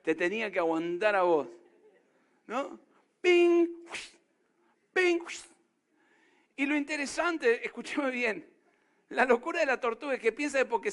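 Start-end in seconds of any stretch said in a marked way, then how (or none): 3.2-5.2 "ping" agudo y fuerte con la boca; golpecitos imitados. Pretend. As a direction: hace el ruido del dedo contra la tortuga. Imita tocar la tortuga. (imita el toque al caparazón, agudo)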